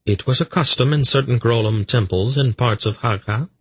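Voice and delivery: high, even female voice; Polgara, composed woman. Acted. Polgara explaining a temple custom, composed and high. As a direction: raise the pitch and explain evenly.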